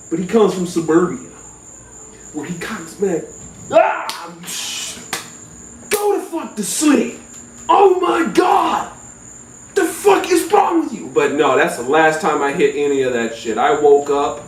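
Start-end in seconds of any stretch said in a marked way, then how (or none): 3.7-11.1 high, exasperated shout; yelling cousin. Retold or imitated. Fed-up cousin shouting at the stoned narrator to sleep.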